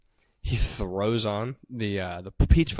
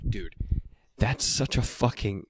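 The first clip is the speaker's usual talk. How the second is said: usual speaking voice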